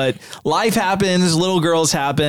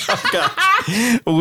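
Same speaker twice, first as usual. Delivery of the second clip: laughing; 8 semitones higher than usual. Real, not acted. Host laughing at cat noise.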